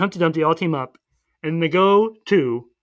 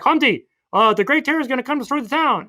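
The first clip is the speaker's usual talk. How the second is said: high-pitched mock alarm; urgent warning; 8 semitones higher than usual. Puss warning Humpty about the town, high urgent mock voice. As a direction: sound a warning in a raised voice.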